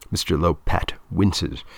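ordinary delivery, nothing marked

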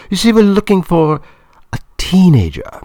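very high, loud woman's voice; Claudia, explaining. A narrator's reading. Film woman explaining her search to Mister Lopat, high and loud. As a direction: pitch it high and project, carefully explaining.

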